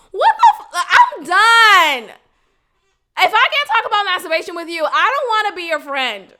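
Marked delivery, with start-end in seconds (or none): 0.1-2.2 much higher, louder cry of disbelief; exasperated outburst. Real, not acted. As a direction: throw up your hands and yell it.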